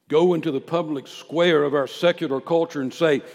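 loud, high preacher's proclamation; full-force preaching. Real, not acted.

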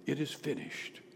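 low, very soft quote; hushed restating of the final cry; subdued recap. Retold or imitated. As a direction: say it quietly, dropped low. Repeats the cry quietly.

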